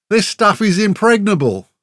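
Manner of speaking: high, loud indignant protest. A narrator's reading. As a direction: insist on it, outraged. Warrior indignantly defends his armour.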